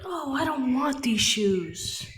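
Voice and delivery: mock a kid throwing a tantrum; bratty child whining about shoes; whiny tantrum kid. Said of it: "throwing an actual tantrum".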